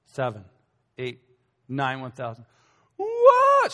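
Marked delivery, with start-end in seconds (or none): 3.0-3.7 sudden high, loud alarmed cry; alarmed what. Retold or imitated. Parent hearing a thump then silence, alarmed. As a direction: jolt up in panic.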